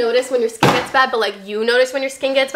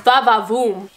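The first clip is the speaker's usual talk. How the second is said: va va voom; 2 semitones lower than usual. Pretend. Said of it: "It just makes your booty like".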